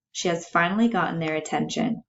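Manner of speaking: ordinary delivery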